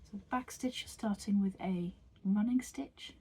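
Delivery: a calm whisper guiding the stitches. Acted. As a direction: murmur each step gently, like close-up ASMR. Whispered tutorial walkthrough.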